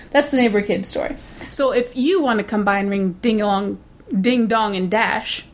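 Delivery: ordinary talk, nothing marked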